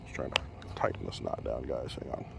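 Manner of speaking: strained words through clenched teeth; talking through teeth. Real, not acted. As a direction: mutter through teeth while pulling a knot. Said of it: "That's why I'm talking through my teeth".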